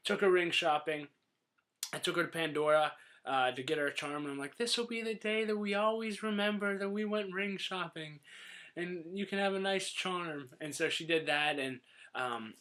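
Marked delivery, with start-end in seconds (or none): 4.6-10.5 sweetly overdone sincerity; mock sentimental. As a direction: make it a big precious moment, lightly put on. Acting sappy on the ring ruse.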